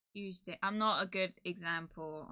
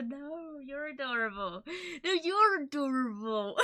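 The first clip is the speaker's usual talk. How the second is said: playful; 6 semitones higher, 4 dB louder than usual. Real, not acted. Playful back-and-forth compliment.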